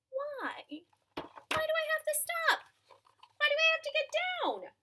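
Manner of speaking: put-on high, fast little-girl voice; child's voice. Toddler Ada questioning her parents, high fast child voice. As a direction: ask like a curious small child. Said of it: "she simply asked".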